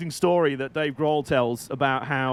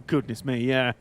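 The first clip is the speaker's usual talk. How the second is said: ordinary delivery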